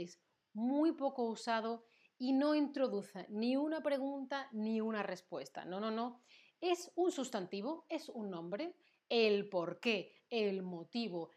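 ordinary delivery

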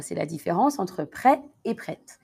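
normal talking voice

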